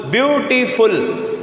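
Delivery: Beautiful slowly in rhythm, view, टी, फुल as three beats; syllable beats. Shown as a demonstration. Teacher showing the breaks pupils will feel, syllable beats. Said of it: "एक लय में बोली फिर भी आपको रुकावट".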